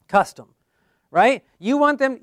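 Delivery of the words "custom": Custom set apart and said loud; stress on Custom. Shown as a demonstration. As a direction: punch the single word Custom. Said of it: "might wanna just emphasize there".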